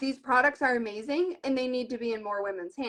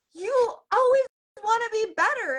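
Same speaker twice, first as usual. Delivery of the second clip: quoted accusation; 10 semitones higher, 3 dB louder than usual. Retold or imitated.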